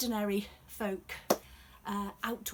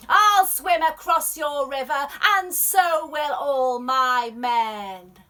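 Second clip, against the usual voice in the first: threatening emperor; 4 semitones higher, 13 dB louder than usual. Acted. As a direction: shout the threat across the river.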